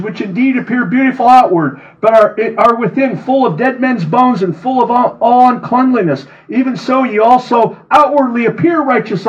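high, ringing sermon voice; fervent scripture reading. Acted. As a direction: preach it at full force. Reads scripture with preaching force.

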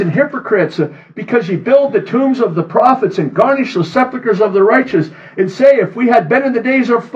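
high, ringing sermon voice; fervent scripture reading. Acted. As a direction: preach it at full force. Reads scripture with preaching force.

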